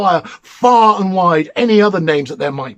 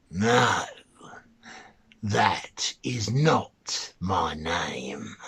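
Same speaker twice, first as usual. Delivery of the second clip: lower, very slow whisper; mannikin whispering; 10 semitones lower, 10 dB quieter, much slower than usual. Acted. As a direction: hiss it out low and slow, gloating. The mannikin refuses, sure of himself.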